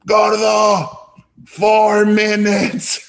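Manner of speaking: high, loud, slow mock shout of a deadline; hammed-up impatient mentor shouting; imitating Guillermo. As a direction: bark the countdown like a stern boss. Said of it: "And he goes".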